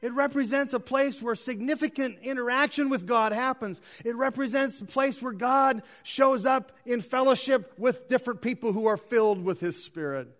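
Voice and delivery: high, impassioned sermon delivery; preaching. Acted. Preacher telling the church what the place represents, preaching high. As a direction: preach it in rolling, repeated phrases, pitched high.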